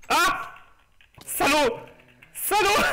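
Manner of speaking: high outraged shout; angry shout. Real, not acted. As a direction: yell at the mob that killed you. Killed by a mob.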